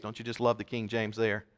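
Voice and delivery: normal talking voice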